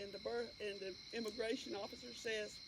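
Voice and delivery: usual speaking voice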